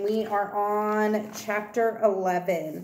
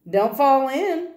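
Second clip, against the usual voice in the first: slow, higher, louder singsong tease; teasing; 6 semitones higher, 5 dB louder, slower than usual. Acted. Girl teases the narrator in the canoe, slow and mischievous. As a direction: drag it out to tease him, full of mischief. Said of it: "she teases".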